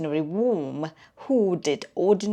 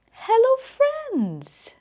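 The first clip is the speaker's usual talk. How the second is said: high, slow friendly greeting; cheerful owl narrator; 3 dB louder, slower than usual. Acted.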